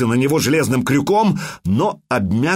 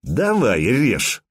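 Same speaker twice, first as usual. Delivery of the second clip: ordinary delivery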